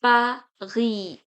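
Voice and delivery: Paris in two syllables, "pa... ree"; French Paris. Shown as a demonstration. Teacher showing the silent S, Paris as "pa-ree". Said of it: "So it will be pronounced as".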